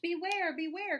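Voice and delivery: high, loud warning call. A narrator's reading. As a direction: call out an urgent alarm as the bees. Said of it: "call the bees to the bear".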